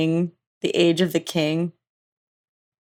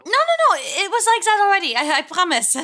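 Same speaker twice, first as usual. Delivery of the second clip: high, fast, flustered cover-up; nervous character voice; 10 semitones higher than usual. Acted. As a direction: rush to change the subject.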